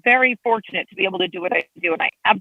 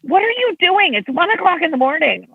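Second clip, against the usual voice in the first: much higher-pitched incredulous questioning; mock astonishment; 9 semitones higher than usual. Official quoting a constituent about a late-night post, high incredulous imitation. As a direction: imitate someone baffled, pitch jumping up.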